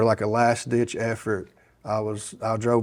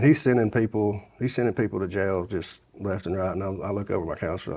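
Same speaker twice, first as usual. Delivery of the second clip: usual speaking voice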